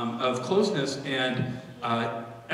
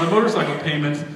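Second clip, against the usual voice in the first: ordinary delivery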